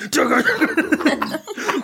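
chugga chugga, guitar riff mimicked with the mouth; chugging guitar riff. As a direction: mouth a heavy metal chug.

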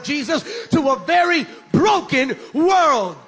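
loud, shouted preaching; full-force preaching. Acted. Preacher prophesying to the congregation, loud shout.

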